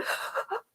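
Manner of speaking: short unvoiced intake of breath; a small imitated gasp of shock; little gasp. Stylist imitating her client's little gasp, soft unvoiced gasp. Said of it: "like this, a little gasp".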